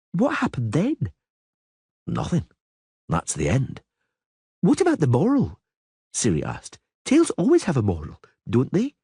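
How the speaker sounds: put-on high, eager child's questions; little girl voice. A narrator's reading. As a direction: play a curious young girl. Child Ciri voiced in story.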